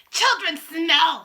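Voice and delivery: slow, high, loud screech; witch screaming. A narrator's reading. Witch ranting to her coven about children, screaming. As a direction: drag out the scream like a furious witch. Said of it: "she screamed".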